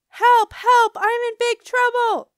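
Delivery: high, very loud cry for help; panicked yell. A narrator's reading. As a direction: yell for mom in alarm. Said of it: "I yelled to Mother".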